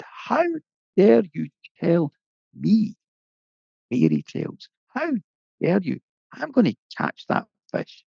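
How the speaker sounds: angry. Acted. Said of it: "it made him really angry".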